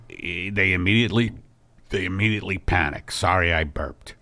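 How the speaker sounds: a low, mellow middle-aged husband easing into news; Al's low voice. Acted. Husband calling his wife in to break big news, low and soft. Al explaining.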